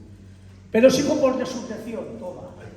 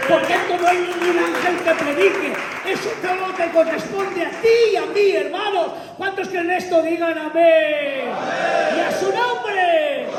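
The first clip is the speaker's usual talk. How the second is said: loud, high-pitched preaching climax; preaching at full force; 5 semitones higher, 3 dB louder than usual. Acted. Preacher rousing the church about the angel, shouting. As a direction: drive the point home to the congregation.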